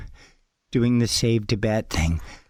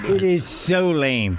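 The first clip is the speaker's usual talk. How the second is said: normal talking voice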